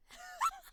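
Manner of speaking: very high squeal of laughter; shrieking giggle. Real, not acted.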